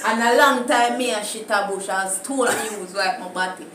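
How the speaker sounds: loud, indignant Jamaican patois protest; laughing patois insistence. Acted. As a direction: insist on your name in patois.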